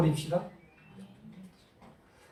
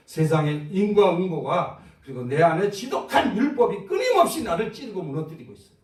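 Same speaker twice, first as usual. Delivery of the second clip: preaching shout; 3 semitones higher, 5 dB louder than usual. Real, not acted. Preacher crying out to the congregation about the law, loud shout. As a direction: shout against the law that tears you down.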